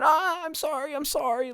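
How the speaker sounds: high, softened mock-apologetic Midwestern squeal; apologetic Midwesterner. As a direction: imitate a sweet lady's sheepish Midwestern apology. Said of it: "gave the old apologetic, like, Midwestern".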